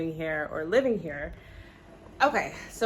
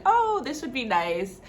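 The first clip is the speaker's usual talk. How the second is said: higher-pitched, drawn-out delighted "oh"; pleased reaction; 7 semitones higher, 3 dB louder, slower than usual. Retold or imitated. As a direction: act out spotting a treat, slow and high. Voicing own reaction in store.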